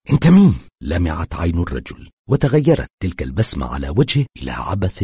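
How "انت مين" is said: higher, louder demand of who he is; alarmed question. A narrator's reading. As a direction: blurt it out, startled. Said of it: "وقال متسائلا". (سؤال مفزوع بصوت أعلى)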